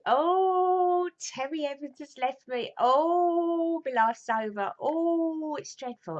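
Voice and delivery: high, wailing mock lament; mock crying. Daughter mimics mum wailing that Terry left her, mock crying. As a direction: melodramatic imitation of a dumped mum. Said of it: "my mum was all crying".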